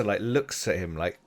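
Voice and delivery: normal talking voice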